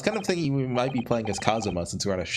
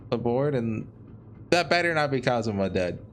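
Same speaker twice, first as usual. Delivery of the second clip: ordinary talk, nothing marked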